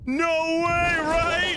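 loud, high disbelief; amazed shout. Real, not acted. Player reacting to friend's one blood midair, amazed shout. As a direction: exclaim it in sheer amazement.

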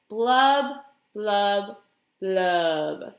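three blubs stepping down in pitch; glum fish blubs. Acted. Pout-pout fish ending his dreary refrain, sinking glum blubs.

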